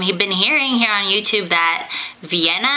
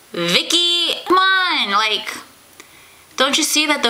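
exasperated; 7 semitones higher than usual. Real, not acted. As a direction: plead in mock disbelief. Scolding TV character.

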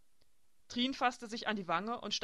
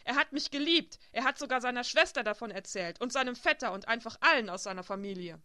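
furious outburst; 3 semitones higher, 9 dB louder than usual. A narrator's reading. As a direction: shout it like a fury. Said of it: "in eine wütende Furie verwandelt". (wütender, hoher Ausbruch)

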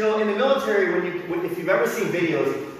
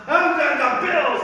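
high-pitched fan yell; rowdy drunk fan; 9 semitones higher, 4 dB louder than usual. Acted. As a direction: imitate a rowdy fan yelling for the team. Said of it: "people are violent. They've been drinking".

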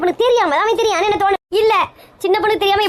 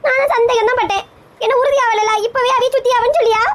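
tearful pleading; 6 semitones higher than usual. Acted. Younger sister fears husband cheating.

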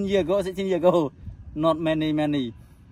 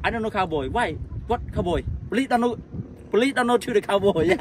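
playing dumb; 9 semitones higher, 6 dB louder than usual. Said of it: "I police say".

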